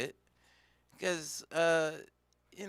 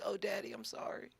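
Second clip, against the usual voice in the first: soft, higher little plea; meek mock apology; 5 semitones higher, 11 dB quieter than usual. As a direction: apologise like a cowed child. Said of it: "He was just like".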